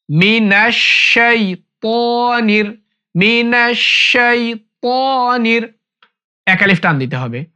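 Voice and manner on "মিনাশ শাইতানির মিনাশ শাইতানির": মিনাশ শাইতানির with a heavy তা, tongue tip on upper teeth; careful slow মিনাশ শাইতানির; correct tajweed demo. Shown as a demonstration. Teacher showing a heavy ত in শাইতানির. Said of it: "তটা মোটা হবে".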